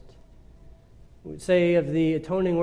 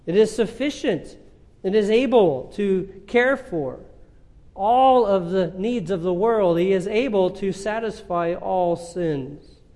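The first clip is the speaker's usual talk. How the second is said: fervent preaching; 5 semitones higher, 6 dB louder than usual. Acted. Preacher to congregation on grace that never runs out, full-force preaching.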